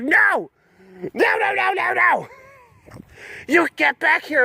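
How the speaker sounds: high frantic no no no; yelling. Pretend. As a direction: chase it down, yelling. Owner yelling at quitting laptop.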